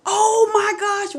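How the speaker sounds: high, drawn-out gasp of astonishment; real high-pitched wonder; amazed exclamation. Real, not acted. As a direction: stretch it out, overcome with amazement. Amazed at her own realization.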